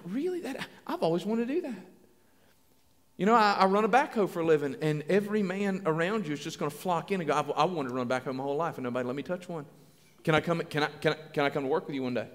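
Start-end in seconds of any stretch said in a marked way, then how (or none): 0.0-1.9 soft mock amazement; hushed, wondering imitation; impressed listener. Acts out impressed response to engineer. 7.4-12.3 low, hurried mock pleading; quick, low-voiced tumbling request; eager admirer. Acts out men envying backhoe operator.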